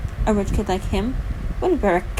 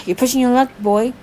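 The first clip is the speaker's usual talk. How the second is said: louder, higher-pitched warning; 3 semitones higher, 6 dB louder than usual. A narrator's reading. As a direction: warn him firmly, raising your voice. Threat to the injured man.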